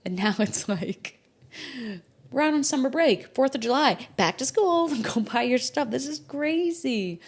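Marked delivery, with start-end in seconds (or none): 2.3-7.2 much higher, louder, half laughing; incredulous rant. Real, not acted. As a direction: list it in mock disbelief. Mocking rushed school calendar.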